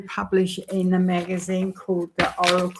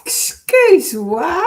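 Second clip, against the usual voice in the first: playful French; 10 semitones higher, 8 dB louder, slower than usual. Real, not acted. Playful apology for camera glitch.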